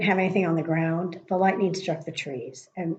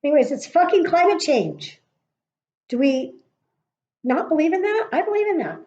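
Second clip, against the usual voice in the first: higher and louder, "fucking" hit hard; exasperated rant; 9 semitones higher, 5 dB louder than usual. Real, not acted. Railing at climate deniers to viewers, high and exasperated. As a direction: burst out in disbelief.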